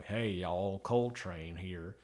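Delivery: slow, low, soft Southern cowboy drawl. Retold or imitated. Reviewer mocking the audiobook's Coltrane, in a slow low Southern drawl. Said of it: "Coltrane is now a Southern drawl cowboy".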